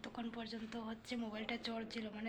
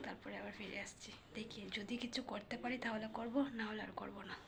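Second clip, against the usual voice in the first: whispering; 6 dB quieter than usual. Real, not acted. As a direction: whisper softly at night. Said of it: "আমি না অনেক আস্তে আস্তে করে কথা বলছি". (ঘুমন্ত শিশুর পাশে ফিসফিস করে)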